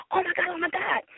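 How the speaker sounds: toned-down imitation of a fan's scream; retold excited screaming. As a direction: lightly re-enact a starstruck freak-out. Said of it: "I'm just screaming".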